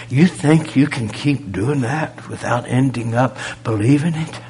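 normal talking voice

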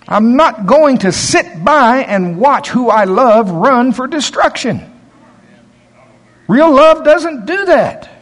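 high, loud, slow preaching; preaching at full force. Real, not acted. Preacher urging the church toward real love, loud and high. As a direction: drive it home to the congregation.

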